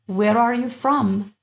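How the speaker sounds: slow, careful classroom English; teacher says each word slowly so students follow; slow clear model. Shown as a demonstration. Teacher models the question slowly for learners. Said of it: "This is the way that we really speak".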